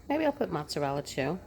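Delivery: usual speaking voice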